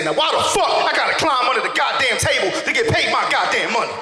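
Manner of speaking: high, heated ranting; indignant outburst. Acted. Cousin protesting his pay arrangement, indignant high rant.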